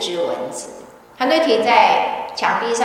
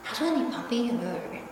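softer, hushed worried sister on the phone; 8 dB quieter, faster than usual. Retold or imitated. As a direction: drop to a near whisper, like asking in secret.

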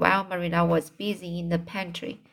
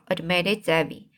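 ordinary delivery

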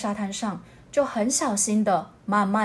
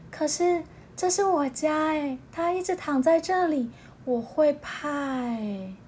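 timid otter; 5 semitones higher, 3 dB quieter than usual. Acted. Otter telling the owl she's scared, high and soft. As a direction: fret nervously about the stranger.

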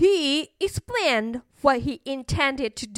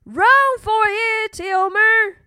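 high, louder urgent warning call; 9 semitones higher, 4 dB louder than usual. A narrator's reading. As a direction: shout a storybook alarm to Elmer. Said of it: "they called".

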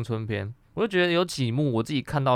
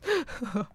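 high squeal laugh; 11 semitones higher, 5 dB quieter than usual. Real, not acted. Laughing at gory memory.

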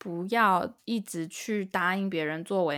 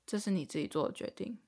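softer, flat and detached, brushing her off; cold dismissive tone; 3 semitones lower, 7 dB quieter than usual. Retold or imitated. Boyfriend coolly lecturing his regretful girlfriend, cold dismissive tone. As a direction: say it like a lecture that doesn't care how she feels. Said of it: "就是这种口气".

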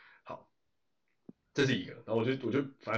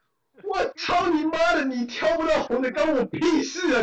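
much higher, louder, slow furious rant; furious retort; 5 dB louder than usual. Retold or imitated. Retelling his reply to the fruit thief, furious. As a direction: blast her with outrage.

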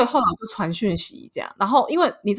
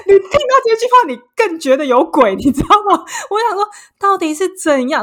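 high, loud, laughing incredulity; worked-up disbelief; 10 semitones higher, 6 dB louder than usual. Retold or imitated. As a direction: retell it in exasperated disbelief, pitch way up. Reliving disbelief at suspicious message.

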